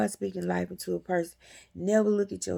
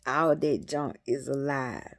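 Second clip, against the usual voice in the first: usual speaking voice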